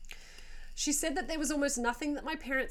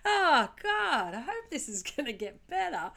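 calm lady voice, sudden loud Oh, God; shocked woman keeping oddly calm; eerily calm; 5 semitones higher, 5 dB louder than usual. Retold or imitated. Voicing calm Maria in crash.

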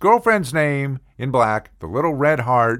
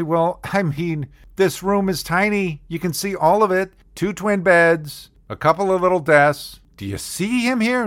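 sarcastic reply; 6 semitones higher than usual. Retold or imitated. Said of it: "And I say".

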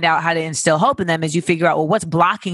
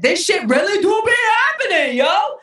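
excited shout; 13 semitones higher than usual. Real, not acted. Excited about manifesting.

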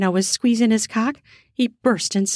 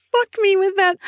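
shouted; 12 semitones higher than usual. Acted. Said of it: "she shouted".

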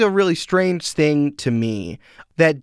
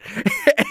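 amused laugh; 13 semitones higher than usual. Real, not acted. Laughing at own bit.

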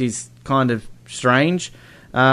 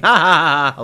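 sarcastic laugh; 3 semitones higher, 4 dB louder than usual. Acted.

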